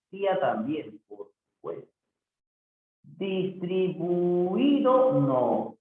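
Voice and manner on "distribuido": slow, drawn-out syllables; sounded-out word. Shown as a demonstration. Teacher checking words for hiatus, slowly sounded out. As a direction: stretch each syllable to test the vowels.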